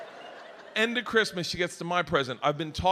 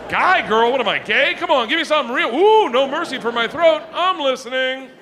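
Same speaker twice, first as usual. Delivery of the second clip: loud rant; 7 semitones higher, 7 dB louder than usual. Real, not acted. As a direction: bellow it to the crowd. Ranting about porn choices.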